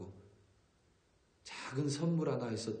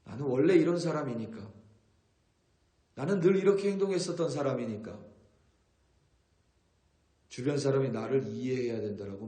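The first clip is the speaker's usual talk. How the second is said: self-justifying quote; 2 semitones higher, 6 dB louder than usual. Retold or imitated. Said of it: "어떤 사람은 이렇게 이야기합니다".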